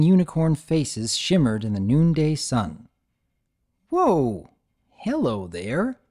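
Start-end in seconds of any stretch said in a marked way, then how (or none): 3.9-6.0 slow, wondering greeting; filly greeting the strange object; awed whoa. Acted. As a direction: marvel at the treasure. Marveling at shiny find.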